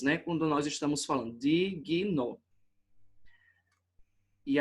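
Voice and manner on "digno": "digno" broken into three sounds with pauses; três pausas em digno. Shown as a demonstration. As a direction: say "digno" as di... gui... no. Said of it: "acontecem aí três pausas". ("digno" em três sons separados por pausas)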